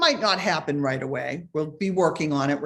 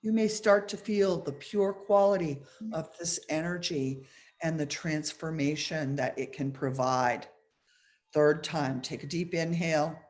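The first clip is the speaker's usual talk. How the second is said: measured meditation guide, pausing between phrases; guided meditation; 4 dB quieter than usual. Real, not acted. Meditation teacher asking listeners to scan the body, guiding calmly. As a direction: lead listeners gently through the exercise.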